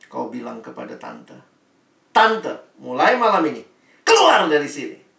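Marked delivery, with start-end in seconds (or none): 2.1-5.1 mock order shouted louder and louder at the landlady; loud mock eviction. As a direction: throw the landlady out, half laughing. Mengusir ibu kos. (anak kos mengusir ibu kos dengan teriakan pura-pura)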